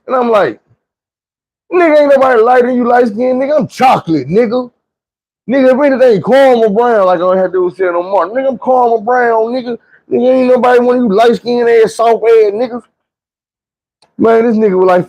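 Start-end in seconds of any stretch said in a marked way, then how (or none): 1.7-12.8 high-pitched, protesting comeback; indignant retort. Retold or imitated. Narrator denying he is lighter, indignant retort. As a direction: insist on it, pitch shooting up.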